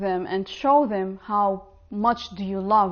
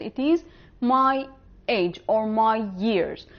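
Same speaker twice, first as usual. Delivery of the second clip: normal talking voice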